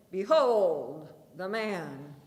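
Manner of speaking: slow, loud proclamation; shouting. A narrator's reading. Governor showing the prisoner to the mob, slow loud call. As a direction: Pilate presents him to the crowd, drawn out. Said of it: "gesturing toward him and shouting".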